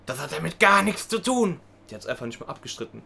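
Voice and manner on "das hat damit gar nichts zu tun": slow, higher mock indignation; defensive clerk. Clerk rebutting the trainee's accusation, slow indignant imitation. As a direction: imitate an affronted clerk, drawing it out. Acted clerk snapping back in quarrel.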